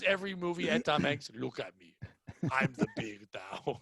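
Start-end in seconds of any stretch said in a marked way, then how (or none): 1.3-3.8 put-on Jamaican accent. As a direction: imitate a hushed Jamaican voice, pitched down. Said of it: "like, a Jamaican voice".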